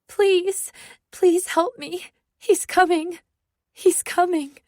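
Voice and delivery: high desperate begging; frightened girl begs the officer for help; terrified pleading. Acted. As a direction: clutch at him and plead in fear. Clutching police officer, terrified.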